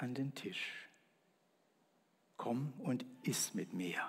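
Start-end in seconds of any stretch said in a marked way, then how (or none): 2.4-4.0 softer, gentle, slow; quiet invitation. Acted. God invites the man to eat, softly. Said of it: "Das nenne ich leise".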